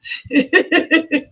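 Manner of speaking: high, loud burst of laughter; incredulous laugh. Real, not acted. Laughing in disbelief at her family's reaction. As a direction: laugh at how absurd it was.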